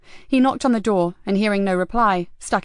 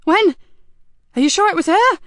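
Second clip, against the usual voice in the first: very high, loud, shocked questioning; alarmed boss, pitch leaping; voice shooting up; 10 semitones higher, 7 dB louder than usual. A narrator's reading. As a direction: demand answers in disbelief. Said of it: "Nikki's voice rose several octaves".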